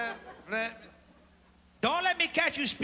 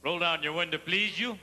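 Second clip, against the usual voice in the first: cop voice; 6 semitones lower than usual. Acted.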